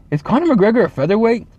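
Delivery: imitating his brother. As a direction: play the brother asking innocently. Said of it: "he goes".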